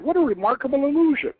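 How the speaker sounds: high, quick, breathless voice of wonder; marveling young woman. A narrator's reading. Pugat admires the eagle's illusion, high and quick. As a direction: rush the words in amazement.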